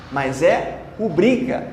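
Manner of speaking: rubrica slowly, ru-BRI-ca with BRI stressed; correct stress. Shown as a demonstration. Teacher insisting on ru-BRI-ca, slowly. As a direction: drag out rubrica, leaning on BRI. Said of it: "muita gente acha que é rúbrica".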